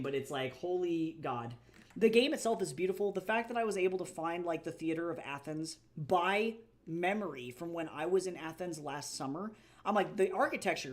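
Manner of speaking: normal talking voice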